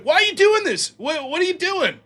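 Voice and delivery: high-pitched pleading complaint; exasperated protest. Retold or imitated. Juggler protesting at being harassed.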